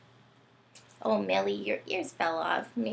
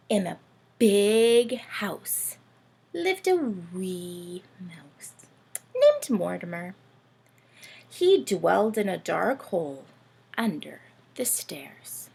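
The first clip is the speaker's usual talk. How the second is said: put-on louder read-aloud to children; teacher reading a Christmas story to the class; storybook reading; 8 dB louder than usual. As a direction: read it like a picture book to little ones. Reading picture book aloud.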